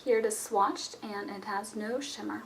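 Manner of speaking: normal talking voice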